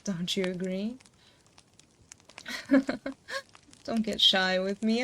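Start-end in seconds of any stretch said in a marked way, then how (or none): 2.4-3.4 light teasing giggle; flirty laugh; playful laugh. Acted. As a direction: laugh at their shyness. Teasing the shy listener.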